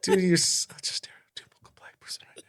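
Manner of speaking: unvoiced, hushed teasing; whispered tease. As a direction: whisper it behind his back. Teasing aside about uncle.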